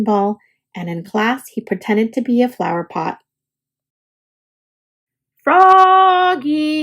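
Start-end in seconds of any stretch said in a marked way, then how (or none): 5.4-6.8 high called name; high calling voice. A narrator's reading. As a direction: call across the room, pitched up. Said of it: "called his teacher".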